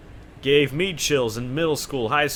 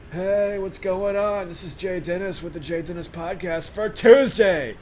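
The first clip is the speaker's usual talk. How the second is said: imitating Uncle Bill's intro; 7 semitones higher than usual. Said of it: "That would be ripping off Uncle Bill".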